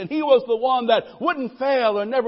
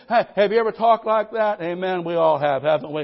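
usual speaking voice

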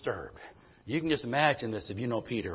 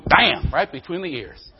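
loud, slow and emphatic; slow loud storytelling punch; punchy mock bam; 5 semitones higher, 12 dB louder, slower than usual. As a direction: hit bam hard like the blow. Story of hitting the donkey.

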